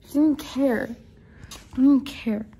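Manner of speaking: loud, high whining complaint; whiny complaint. Real, not acted. Complaining loudly about friend.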